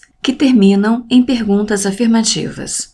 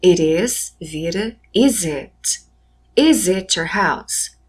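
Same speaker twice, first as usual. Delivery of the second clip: slow model English; slower than usual. Shown as a demonstration. Teacher modelling English example.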